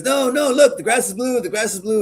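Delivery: high-pitched, insistent donkey voice. Acted. Donkey arguing back to the tiger, high and insistent. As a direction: insist excitedly, pitched well up. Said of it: "And he goes".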